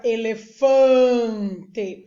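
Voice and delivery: elefante with a long, loud FAN; stress on AN. Shown as a demonstration. Teacher pointing out the an sound, stretching FAN in elefante. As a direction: hold and swell the nasal an in elefante. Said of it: "Perceba aí que tem o an na palavra".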